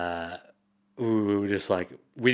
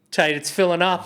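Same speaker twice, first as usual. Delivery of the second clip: mock panic; 7 semitones higher, 5 dB louder than usual. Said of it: "you can hear the panic in his voice".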